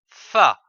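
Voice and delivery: "fa" said loudly and clearly as a model; loud syllable demo. Shown as a demonstration. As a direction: call out the syllable "fa" for the class. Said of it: "F-A".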